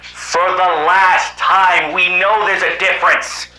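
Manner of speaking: ordinary delivery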